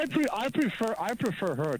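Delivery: ordinary talk, nothing marked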